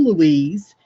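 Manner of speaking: normal talking voice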